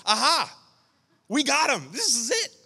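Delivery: high, loud cry of victory; triumphant. Retold or imitated. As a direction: crow like you just caught the culprit. Retold triumph on catching the thief.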